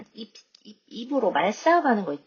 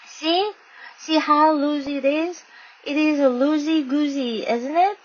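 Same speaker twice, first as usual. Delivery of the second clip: put-on high, sweet little-girl voice; cute little girl; 5 semitones higher, much slower than usual. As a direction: show off the tooth cutely. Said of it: "I smiled very cute". (귀엽게 조르는 어린 여자아이)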